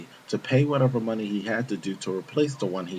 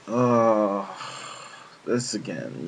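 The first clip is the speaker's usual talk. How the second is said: exasperated; much slower than usual. Retold or imitated. Desk worker hearing Oscar is back, exasperated. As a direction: let out a fed-up sigh. Weary reaction to repeated trouble.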